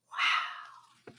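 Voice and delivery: whispered, awed wow; breathless wow. A narrator's reading. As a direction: breathe the word out, stunned. Teacher left speechless.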